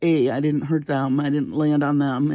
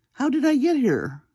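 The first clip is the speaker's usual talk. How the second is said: pitched up, bewildered inner question; dazed disbelief; 8 semitones higher than usual. Retold or imitated. Woman on the floor asks herself, bewildered high. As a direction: ask it like you just came to on the floor. Dazed thought after falling.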